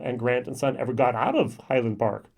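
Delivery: ordinary delivery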